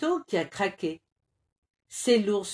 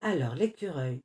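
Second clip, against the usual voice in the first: ordinary delivery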